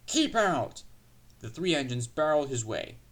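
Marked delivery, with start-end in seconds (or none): 0.1-0.8 high, forceful warning; barring shout. A narrator's reading. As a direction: shout it to block the way. Engines bar Duck from the shed.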